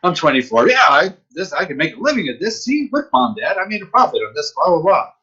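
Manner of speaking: high-pitched, giddy mock boasting; cocky young bragging. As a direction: imitate your naive self showing off to parents. Young self bragging to parents.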